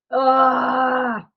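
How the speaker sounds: a short pained ugh; disgusted groan. Real, not acted. Describing how she was treated.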